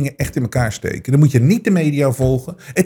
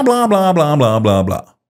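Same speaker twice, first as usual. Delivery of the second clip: dismissive blah blah. Retold or imitated. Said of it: "Maar die mensen".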